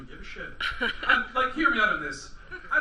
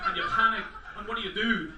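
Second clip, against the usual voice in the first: ordinary talk, nothing marked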